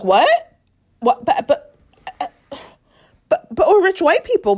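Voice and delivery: imitating affronted rich people; flustered disbelief. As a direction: stammer in high, hammed-up shock. Voices couple caught out, spluttering.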